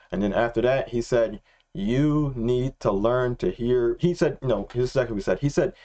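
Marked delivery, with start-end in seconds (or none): 1.7-4.0 very slow quote, each word spaced out; drawn-out, weighty retelling; slow, deliberate. Retold or imitated. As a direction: deliver it as a measured instruction. Quoting God's words.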